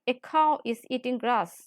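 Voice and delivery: normal talking voice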